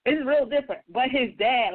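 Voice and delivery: ordinary delivery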